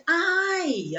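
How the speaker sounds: a tight little pinched I. Shown as a demonstration. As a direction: squeeze the I like a pinch. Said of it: "lembra que é um beliscão".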